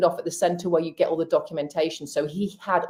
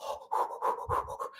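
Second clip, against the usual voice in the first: gasp; 11 dB quieter than usual. Retold or imitated. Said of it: "And I went".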